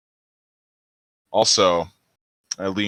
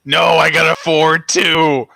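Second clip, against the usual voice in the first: mock outrage; 8 semitones higher, 7 dB louder than usual. Real, not acted. Player reacting to failed roll.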